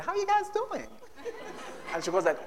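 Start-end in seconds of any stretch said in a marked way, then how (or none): 0.0-0.9 high, exaggerated American accent; mock American accent. Imitating a sister just back from the US, mock American accent. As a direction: ham up a returnee's American twang. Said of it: "speaking to us in an accent".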